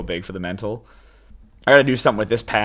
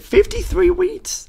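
excited; 5 dB louder, slower than usual. Real, not acted. Excited counting of the haul.

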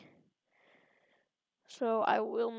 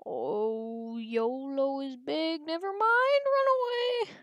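hammed-up dread rising as you flee; mounting playful panic; 3 semitones higher, slower than usual. Fleeing a bigger player.

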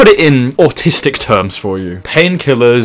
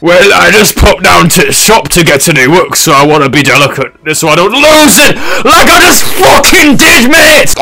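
northern pirate building to a high shriek; hammed-up northern pirate rant; 5 semitones higher, 4 dB louder than usual. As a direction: start chatty northern, end in a northern-accented yell. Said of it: "why have you gone northern".

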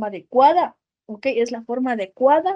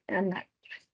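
an actress tossed off softly and lower; quiet recap; 6 semitones lower, 14 dB quieter, slower than usual. Shown as a demonstration. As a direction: drop it softly as a quick summary. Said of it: "Ok, miren". (repaso en voz baja y rápida)

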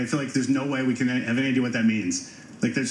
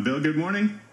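normal talking voice

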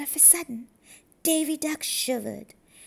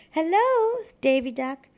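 high, loud hello across the water; a turtle girl calling out a loud, high greeting; 5 semitones higher, 8 dB louder than usual. Acted. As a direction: call out to your friend on the dock. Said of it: "Dee Dee called".

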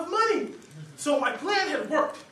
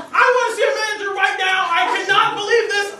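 loud, high, indignant complaint; feigned outrage; 8 semitones higher, 13 dB louder, faster than usual. Acted. Diner demanding the manager, hammed-up indignant shout. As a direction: make a big show of being scandalized.

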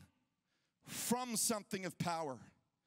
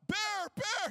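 high, loud alarmed cry; yelling out; 13 semitones higher, 5 dB louder than usual. Retold or imitated. Dad spotting real bear prints, yelling out in alarm. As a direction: shout a warning, frightened. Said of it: "I start yelling out".

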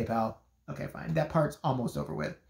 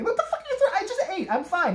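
incredulous protest. Retold or imitated. Friend throwing crackers at her.

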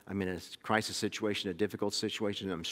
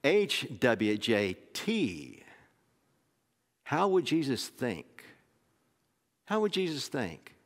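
drawn out, rising motto; preacher coining a new motto for the congregation, slow and emphatic; 3 semitones higher, much slower than usual. Shown as a demonstration. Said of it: "we probably ought to have a".

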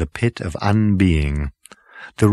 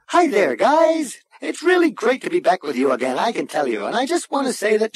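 very high, relentlessly cheerful machine voice; chirpy ship's computer; 14 semitones higher than usual. A narrator's reading. Computer greets the returning crew, chirpy. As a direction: gush brightly as an over-friendly computer.

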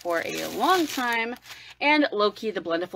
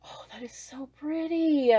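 breathless hushed whisper; awed whisper; 6 semitones higher than usual. Real, not acted. Seeing the pressed glitter, awed whisper. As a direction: whisper it in awe.

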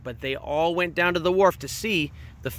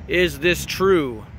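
slow, a hint of a thundering fish; the big fish questions the other fishes, slowly; much slower than usual. A narrator's reading. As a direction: draw the question out like rolling thunder. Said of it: "with a voice like thunder".

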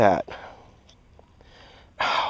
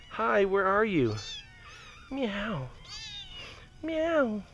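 soft kitten call; 8 semitones higher, 10 dB quieter, much slower than usual. Real, not acted. Softly calling a kitten.

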